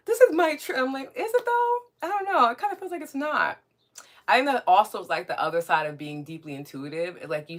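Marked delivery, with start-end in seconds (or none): 0.1-0.8 high, loud imitation of the offended; hammed-up high-pitched outrage; mock indignant protest. 1.2-3.6 very high, singsong doubt; exaggerated high-pitched skepticism; mock skeptical reply. Acts own doubting reply to them.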